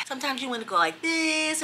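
lilting singsong influencer imitation; mock the YouTube singsong; YouTuber sing-song voice. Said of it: "sounds like they're singing a song".

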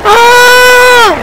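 very high sharp scream; panicked scream. Real, not acted. Gamer being killed in game, shrieking. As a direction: let out a real shriek of panic. Caught by zombie.

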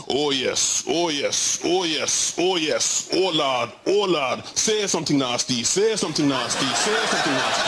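lightly voiced mock moaning stepdad; understated stepdad moaning through the wall; stepdad in bed. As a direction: ham up the stepdad's passion a touch. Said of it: "I can hear my stepdad going".

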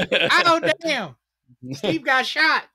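high, loud mock gasp of a fan; mock shocked fan. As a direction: play a stunned concertgoer, half laughing. Mock concertgoer reacting to shooting.

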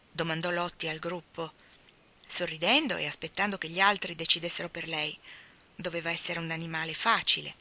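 ordinary delivery, nothing marked

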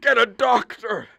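high, loud plea for a doctor; yelling for help while hurt; urgent shout. Acted. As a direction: shout it out in pain. Injured man demands help.

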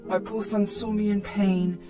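plain reading voice

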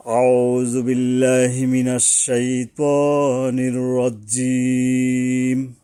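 slow, drawn-out Quranic chanting; slow recitation. Shown as a demonstration. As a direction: chant each word slowly and evenly. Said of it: "শুরু করতে হবে এভাবে". (ধীর তেলাওয়াতের সুর)

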